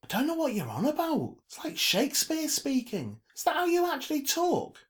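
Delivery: high, loud, incredulous voice; high-pitched puzzled irritation; brash Mina, baffled. A narrator's reading. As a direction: question them in exasperated disbelief. Mina questions Trevor's speech.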